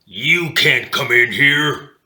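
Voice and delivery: loud, slow tough-dog bark; gruff bulldog guard. A narrator's reading. Bulldogs turning away the narrator, loud and slow. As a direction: block the door like a bouncer dog.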